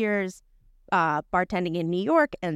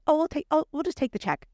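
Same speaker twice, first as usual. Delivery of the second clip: higher-pitched imitation of a casual woman; imitating breezy, casual unconcern; super nonchalant; 9 semitones higher than usual. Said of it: "Like, super nonchalant, casually, whatever".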